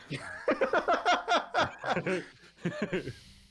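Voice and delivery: laughing. Real, not acted. Player cracking up at the justice joke, laughing. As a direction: burst of real laughter at the joke.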